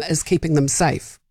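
usual speaking voice

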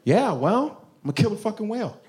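ordinary delivery

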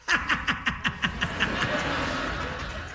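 airy unvoiced laugh; soft laughter at the story; breathy laugh. Real, not acted.

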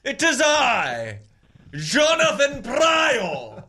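long, drawn-out crier's call; town crier. Acted. Host announcing himself as official town crier, grand slow proclamation. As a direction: proclaim your own title, stretching every word. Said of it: "allowed us to be the town crier".

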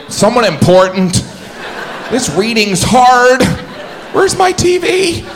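high, slow, whiny complaint; hammed-up high-pitched frustration; struggling reader. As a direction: give up in a high, drawn-out voice.

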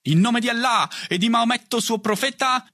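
high declaiming, light mock Arabic accent; invented Arabic accent. A narrator's reading. Boy retorting in mock duel, high invented Arabic accent. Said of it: "inventandosi persino un accento arabo".

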